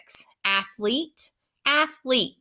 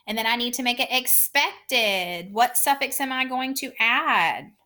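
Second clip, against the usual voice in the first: ordinary talk, nothing marked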